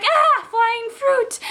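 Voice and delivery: high, drawn-out mock scream; mock panic. Roommate seeing fruit fly at her, mock panic. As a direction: squeal it slowly in exaggerated alarm. Roommate startled by flying orange.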